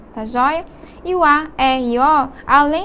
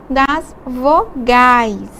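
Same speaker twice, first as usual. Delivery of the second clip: vogais stretched very slowly and a bit louder; drawn-out emphasis; 5 dB louder, much slower than usual. Shown as a demonstration. Teacher to class, slowly stressing where accents go. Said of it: "eles estão em cima".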